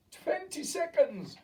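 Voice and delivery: high, loud, slow witch cry. A narrator's reading. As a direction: stretch out the count triumphantly.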